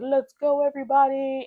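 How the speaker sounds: high, loud fitness-instructor call; rally the class, bright and loud; peppy instructor call. Retold or imitated.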